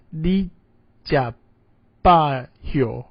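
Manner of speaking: "Li jia ba hiu" with the final sound clipped to "hiu"; Taiwanese pronunciation. Shown as a demonstration. Teacher models the greeting for the class to repeat, syllable by syllable. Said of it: "Can you pronounce that".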